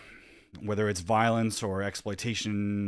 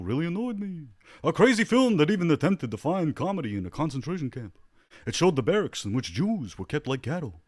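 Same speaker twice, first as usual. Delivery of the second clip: Jackie Mason impression; 6 semitones higher, 3 dB louder than usual. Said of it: "that's my terrible Simpsons Jackie Mason, uh, impression".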